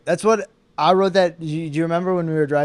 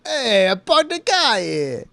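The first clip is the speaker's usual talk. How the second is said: put-on higher, slow Boston accent; 7 semitones higher, slower than usual. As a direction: ham up a Boston guy. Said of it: "do Boston accents".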